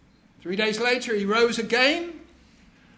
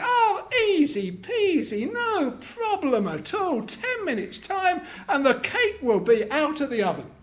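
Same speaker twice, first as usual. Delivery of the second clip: higher, breezy overconfident husband; cocky husband; 6 semitones higher than usual. Acted. Husband brushing off the cake task, breezy and higher. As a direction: toss it off like it's nothing. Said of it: "the husband says".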